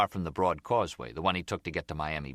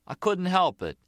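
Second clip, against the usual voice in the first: put-on high, louder little-kid excuse; childish protest; 12 semitones higher, 5 dB louder than usual. Nicky excusing his slip to Gloria, sounding like a little kid. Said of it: "you sound like a little kid".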